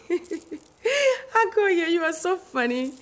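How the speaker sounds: laughing high as she answers Agoye; real laughter. Real, not acted. To viewer Agoye about his funny comment, laughing. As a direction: burst out laughing, then talk through it.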